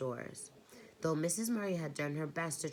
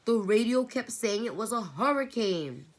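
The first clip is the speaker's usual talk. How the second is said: higher, louder frightened cry; panicked girl insisting on the storm; wailing; 6 semitones higher, 7 dB louder than usual. A narrator's reading. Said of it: "Meg wailed".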